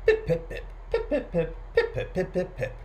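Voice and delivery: short, popping high pips in threes; quick staccato robin imitation; robin pipping. A narrator's reading. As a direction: pip along like a little bird. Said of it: "walking along sounding like pip".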